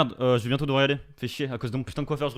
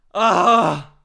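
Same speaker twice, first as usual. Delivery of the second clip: loud relief shout; 4 semitones higher, 12 dB louder than usual. Real, not acted. Relief after winning.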